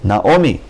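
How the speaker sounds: "Naomi" with an o in the middle, not Naami; o sound. Shown as a demonstration. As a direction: say "Naomi" with a clear o. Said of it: "we read it Naomi".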